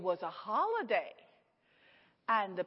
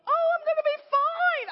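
much higher and louder, breezy and sure; chirpy overconfident; 8 dB louder than usual. Retold or imitated. Sixteen-year-old reassuring the older nurses, high chirpy confidence. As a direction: brush it off brightly. Naive reply to worried nurses.

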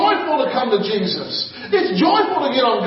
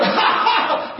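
higher, deliberate forced ha ha ha; forced laugh; 5 semitones higher than usual. Retold or imitated. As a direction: laugh on purpose without feeling it. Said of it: "He started to laugh".

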